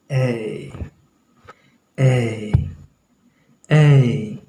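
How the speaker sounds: ए drawn out as a gliding "ay"; British English A. Shown as a demonstration. Teacher models the British letter A, long gliding ए. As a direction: stretch ए into a long ay diphthong. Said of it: "British English में बोला जाता है".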